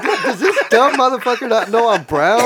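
high, loud laughing disbelief; cracking up in disbelief; laughing incredulous. Retold or imitated. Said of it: "he's like".

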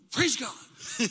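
loud, punchy exclamation; sudden burst of praise; preacher's praise. Retold or imitated. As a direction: belt it out like a preacher. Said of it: "a preacher would say".

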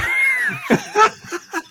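shrieking laugh; high burst of laughter. Real, not acted. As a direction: crack up loudly. Big laugh.

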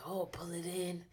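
whispered mock meditation cue; imitating whisper. Friend mimicking her pal's whispered meditation cue. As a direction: hush it like the yoga guide. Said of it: "you was like".

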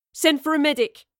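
sharp loud command; order. A narrator's reading. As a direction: bark the order to the team. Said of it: "Elwood ordered".

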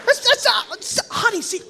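high, excited stammer to the wife; mock triumphant "told you so"; vindicated husband. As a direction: stumble over it, high and thrilled. Vindicated husband turns to his wife.

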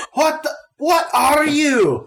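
put-on high, loud, slow halfling sputter in a botched Scottish accent; a garbled Scottish-accented shriek of shock; halfling caught off guard, sputtering in a Scottish accent; bad Scottish accent. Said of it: "terrible Scottish accent instead of Irish".

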